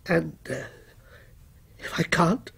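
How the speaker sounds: soft, hesitant question; whispered. A narrator's reading. Victim asks his tormentor the cost of failing, hushed. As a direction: ask it haltingly under your breath. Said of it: "whispered Walter".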